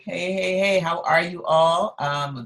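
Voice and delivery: normal talking voice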